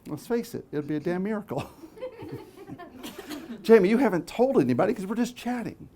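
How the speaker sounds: usual speaking voice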